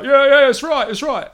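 high, wary London thug, loud; nervous thug caught at knifepoint; collared ruffian pleading with the captor. Acted. Second thug agrees.

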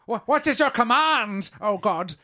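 high, loud awed creature voice; groveling creature. Acted. Fish creature groveling to the player's fake god, high and awed. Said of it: "And it".

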